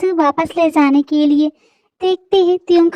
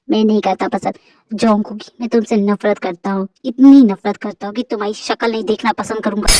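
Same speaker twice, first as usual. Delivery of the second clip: lowered, cold accusing male voice; angry husband; 8 semitones lower than usual. Acted. Husband throwing his wife out, lowered and cold. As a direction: lay out every betrayal, deep and hard.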